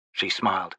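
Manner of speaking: plain reading voice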